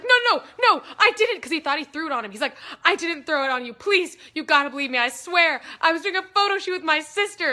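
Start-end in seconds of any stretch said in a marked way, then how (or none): 0.0-1.4 high, loud panicked denial; panicked pleading. Retold or imitated. Pleading with cop, retold. 2.8-7.5 loud, begging protest; desperate pleading. Retold or imitated. Pleading with cop, retold.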